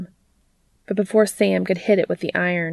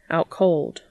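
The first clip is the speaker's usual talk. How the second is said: ordinary delivery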